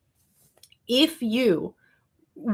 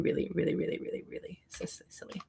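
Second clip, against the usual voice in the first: really, really fading into a whisper; 13 dB quieter, faster than usual. Real, not acted. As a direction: let the words die away to a breathy whisper. Trailing off mid-rant.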